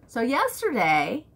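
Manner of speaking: usual speaking voice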